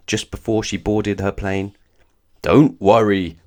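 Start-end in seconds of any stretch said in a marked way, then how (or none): 2.4-3.4 big loud booming Santa voice; jolly Father Christmas booms. Acted. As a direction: boom it out reassuringly. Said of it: "boomed Father Christmas".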